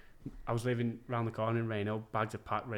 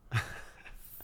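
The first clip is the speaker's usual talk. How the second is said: breathy laugh. Real, not acted. Stunned reaction to Rangers call.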